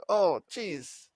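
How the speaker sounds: a quick mock British "Oh, jeez"; fake British accent. Shown as a demonstration. Showing how British people talk, fake British accent. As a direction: toss off a silly British accent. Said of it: "The way British people talk".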